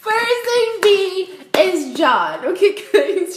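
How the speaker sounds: very high, loud singsong announcement; gleeful shrieked reveal. Real, not acted. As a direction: squeal the big reveal slowly, stretching each word.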